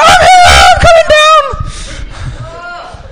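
very high, loud excited screech; ecstatic contestant scream. Retold or imitated. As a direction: scream it like a contestant racing to the stage. God as excited game show contestant.

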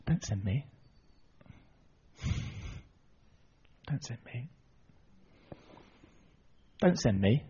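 very soft, reluctant begging; timid plea. Retold or imitated. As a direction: shrink back and plead quietly. Moses shrinking from God's call.

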